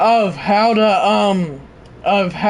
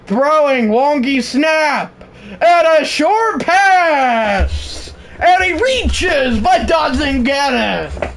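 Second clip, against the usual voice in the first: live play-by-play; 4 semitones higher, slower than usual. Acted. Streamer calling the Alabama drive to chat, excited. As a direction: build the call to a yell as the pass sails incomplete.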